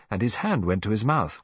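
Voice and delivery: ordinary delivery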